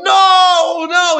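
high, loud frantic no; desperate shout. Retold or imitated. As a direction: scream it to stop him. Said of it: "they're going".

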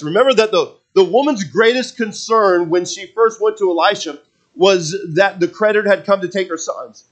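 loud, high sermon delivery; forceful preaching. Real, not acted. Preacher tells congregation of the widow's miracle, forceful preaching. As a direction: drive it home from the pulpit.

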